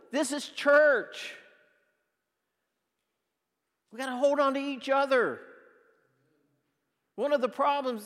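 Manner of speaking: high and loud fervent preaching; rally the flock to hold on; preaching at full force. Acted. Pastor urging his church to speak up, loud and fervent.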